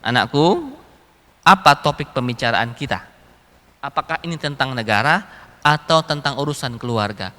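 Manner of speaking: low, measured fatherly question; Umar. Retold or imitated. Caliph asks his child whether this is state or family business, grave. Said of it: "Kata Umar".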